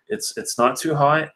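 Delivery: ordinary talk, nothing marked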